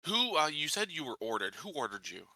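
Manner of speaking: ordinary talk, nothing marked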